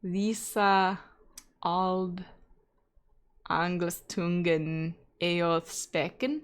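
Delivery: put-on slow, careful reading of Old English; tentative reading of an old text; sounding out Old English. Shown as a demonstration. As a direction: sound out "Visa ald Anglistungen eoth speken" syllable by syllable. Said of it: "okay, so if that's an S".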